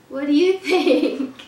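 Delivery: loud, slow question breaking into a chuckle; drawn-out, amused question with a laugh. Acted. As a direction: ask the children playfully, laughing. Reader laughingly asks the young listeners.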